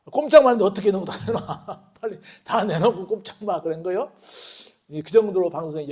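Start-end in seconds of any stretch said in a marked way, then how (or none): 0.1-3.6 laughing. Real, not acted. Retelling absurd robber exchange.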